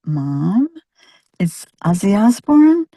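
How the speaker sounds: a hint of an incredulous child correcting a parent; mildly voiced son. Son to mother lecturing a rock star, lightly imitated disbelief. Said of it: "my son looking at me like".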